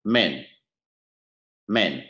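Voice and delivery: "Men" with a brief, relaxed E; short vowel. Shown as a demonstration. Teacher contrasts the second column sound, short relaxed vowel. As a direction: cut "Men" short, mouth loose. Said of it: "âm này chúng ta đọc là men".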